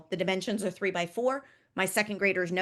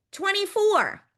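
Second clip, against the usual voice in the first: high, loud last number; 10 semitones higher, 4 dB louder, slower than usual. Shown as a demonstration. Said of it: "twenty-four was our loud number".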